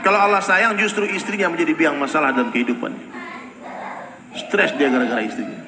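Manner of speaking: ordinary delivery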